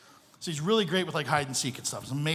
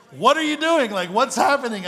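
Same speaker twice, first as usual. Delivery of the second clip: high, loud alarmed what are you doing; startled shout; 7 semitones higher, 11 dB louder than usual. Retold or imitated. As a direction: yelp it out in shock. Owner startled by sweaty pastor.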